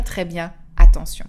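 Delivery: très bien attention lancé bien plus fort; martèle très bien attention; insistance. Real, not acted. Insiste que le cerveau écoute. (insiste avec force sur très bien attention)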